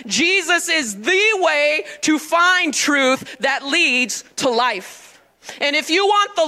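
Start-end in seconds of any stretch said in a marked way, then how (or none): 0.0-5.2 drawn out, word by word; very slow emphasis. Shown as a demonstration. Pastor repeats key verse, very slow and weighty. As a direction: let every word land slowly. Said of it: "I've been chewing on it".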